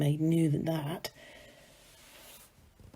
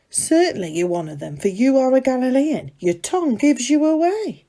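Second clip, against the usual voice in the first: accusing; 6 semitones higher, 6 dB louder than usual. Acted. Maid accusing the Galilean by fire.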